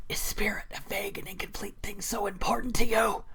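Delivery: hushed, whispered villain quote; Cyrus voice. Acted. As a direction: whisper it like a brooding edgelord. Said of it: "hearing my Cyrus voice".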